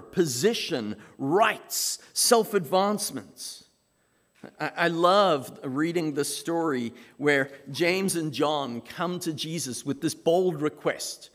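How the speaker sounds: ordinary delivery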